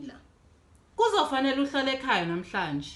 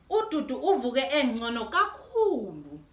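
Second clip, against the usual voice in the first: ordinary delivery, nothing marked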